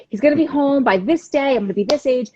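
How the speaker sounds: high-pitched hopeful prediction; retold excitement. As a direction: relive the excited certainty. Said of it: "We all got all excited".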